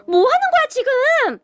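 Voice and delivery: high, drawn-out indignant cry; outraged yell. A narrator's reading. Husband splashed with water, acted high outraged yell. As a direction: yell in high outrage without extra volume. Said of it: "이라며 소리를 질렀어요".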